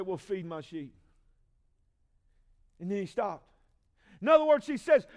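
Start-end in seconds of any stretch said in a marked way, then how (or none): 0.0-0.9 gentle. Acted. Jesus answers gently.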